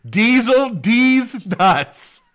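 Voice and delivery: slow, high, drawn-out announcer voice; announcer drawl. Pretend. Host reading out a viewer's joke name, drawn out.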